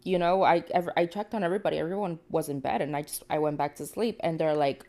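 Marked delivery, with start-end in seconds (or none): none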